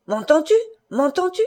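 high, rising repeated demand; exasperated fury. A narrator's reading. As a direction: shake an answer out of her. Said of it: "Il tremblait de fureur". (exaspéré, voix montante)

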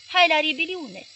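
slow, high, loud holler repeated. Retold or imitated. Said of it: "ieșit înainte hăulind".

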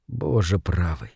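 low, slow, soft exclamation; hushed awe. A narrator's reading. Moore recalling the monster underwater, hushed and awed. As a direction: drop right down, as if to yourself.

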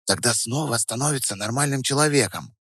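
put-on hushed girlish aside; stage whisper. A narrator's reading. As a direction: answer under your breath, confidentially. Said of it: "ответила Настя тоже шёпотом".

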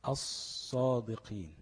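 very slow الصادقين with a long hissed ص, no L; lam merged into sad. Shown as a demonstration. Teacher demonstrating merging to students, slow الصادقين with held ص. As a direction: draw out the doubled ص and long vowels of as-sadiqin. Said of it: "قلبت لصاد وادغمت الصاد".

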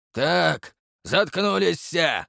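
slow stern command; gruff bark. Acted. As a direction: bark it to shut them up. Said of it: "рявкнул сержант". (резкий окрик)

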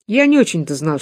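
ordinary delivery, nothing marked

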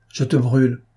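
plain reading voice

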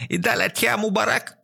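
choked high cry in Arabic; strangled shout. A narrator's reading. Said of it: "выкрикнул, словно его душили".

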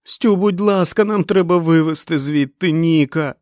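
high, loud pleading; screaming. A narrator's reading. Fran begs Stu to rescue Nick, screaming. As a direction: scream a desperate plea. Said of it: "закричала Френ".

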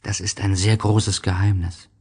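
plain reading voice

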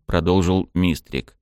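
plain reading voice

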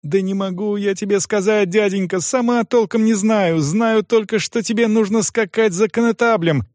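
high-pitched anxious insistence; young girl begging an adult to believe her; pleading girl. A narrator's reading. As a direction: explain helplessly, high and urgent. Girl pleads with doubting groom.

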